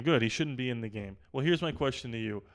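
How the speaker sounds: ordinary talk, nothing marked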